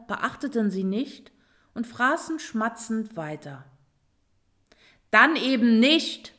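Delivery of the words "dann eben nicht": loud, annoyed outburst; huffy, forceful dismissal; annoyed exclamation. A narrator's reading. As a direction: snap it out crossly and loudly. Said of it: "rief Roswitha ärgerlich".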